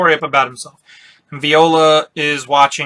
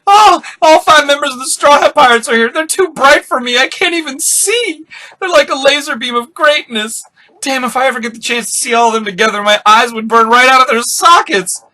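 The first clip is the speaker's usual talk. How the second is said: very high, overwhelmed fanboy gushing; hammed-up starstruck wail; Bart fangasming; 8 semitones higher than usual. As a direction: gush as if blinded by your idols. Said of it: "Bart's fangasming again".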